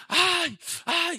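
high, loud wailing cries; grieving mother wailing to the pastor. Retold or imitated. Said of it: "llega la señora Porfiria".

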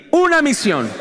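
"Una misión" said stronger after "más fuerte". Shown as a demonstration. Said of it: "A ver, más fuerte". (repetición más fuerte de "Una misión")

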